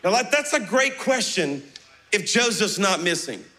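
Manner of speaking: ordinary delivery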